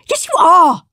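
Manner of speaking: high, loud know-it-all scold; bossy Henry. A narrator's reading. Big brother Henry scolding Peter, loud and bossy. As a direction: correct him like a smug big brother. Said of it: "said Henry".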